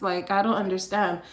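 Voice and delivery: ordinary talk, nothing marked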